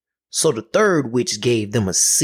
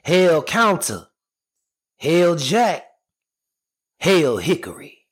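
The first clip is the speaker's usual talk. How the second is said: hollering; 7 semitones higher than usual. Acted. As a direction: holler out for the hounds. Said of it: "the brother hollered again".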